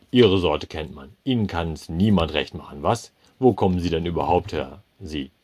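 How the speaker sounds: contemptuous manager. A narrator's reading. Manager berates the foreigner, loud and contemptuous. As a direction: sneer it loudly at him.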